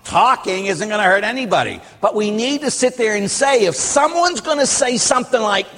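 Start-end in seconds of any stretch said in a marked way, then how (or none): none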